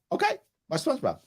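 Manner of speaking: high, airy dad shrugging it off; breezy indifference. Retold or imitated. Dad to daughter skipping homework, breezy. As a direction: say it like it doesn't matter at all. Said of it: "I'm like".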